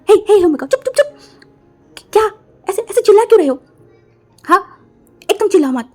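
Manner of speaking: high loud frantic shushing; urgent loud shh-ing; panicked hushing. Acted. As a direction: hush him in a panic.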